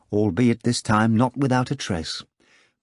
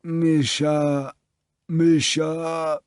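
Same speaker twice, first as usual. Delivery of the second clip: weak, drawn-out moan, pitched up; 4 semitones higher, much slower than usual. A narrator's reading. Hungover man calling his neighbour for help, moaning. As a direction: call out in a long helpless moan. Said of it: "and twice moaned".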